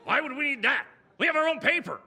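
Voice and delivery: high-pitched, blustering dismissal; boss's incredulous holler; loud indignant retort. Acted. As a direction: shout down the silly suggestion. Boss loudly dismisses the joke.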